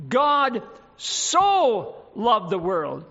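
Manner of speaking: so stretched and weighted, very slow; slow down and press on so; stress on so. Shown as a demonstration. Preacher rereads the verse to the congregation, stressing so. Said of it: "Emphasis on that little word".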